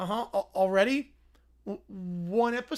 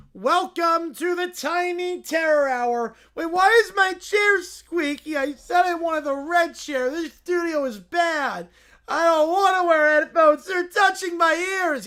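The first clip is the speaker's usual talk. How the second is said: put-on high, louder spoiled kid voice; a bratty little boy complaining; whiny child host; 7 semitones higher, 8 dB louder than usual. Caillou hosting his radio show, whining.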